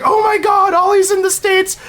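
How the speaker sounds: very high mock squeal of excitement; imitated excited crowd. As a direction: squeal the news like thrilled fans. Imitates everyone reacting to the news.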